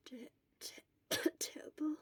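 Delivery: weak, faint stammer broken by a cough; stuttering through a cough. Acted. Feverish boy stammers and coughs answering the nurse. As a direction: cough it out and barely finish the word.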